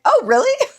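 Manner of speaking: high-pitched, giggly feigned amazement; acted polite surprise. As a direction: replay being surprised by a stranger. Acts out surprise at being recognized.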